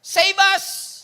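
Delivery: shouted high and loud, Save us!; a pleading shout for rescue; desperate cry. Retold or imitated. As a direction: cry it out like a begging crowd. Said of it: "should be translated as".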